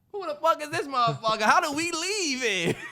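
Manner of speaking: high, loud, laughing bewildered villager; mock villager. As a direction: play a baffled local spotting a stranger. Mocking villagers seeing Terrell.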